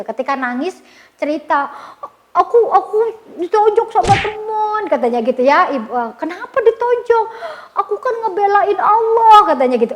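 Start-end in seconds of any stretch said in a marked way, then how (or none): 2.3-4.8 high-pitched mock tearful whine; imitating crying child. Boy reports being punched to his mother, mock crying. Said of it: "pulang katanya nangis gitu". 6.2-7.3 voiced questioning mother. Mother questioning her crying child. 7.8-9.4 high, louder mock whine; imitating crying child. Boy explains he was defending Allah, mock tearful. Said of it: "pulang katanya nangis gitu".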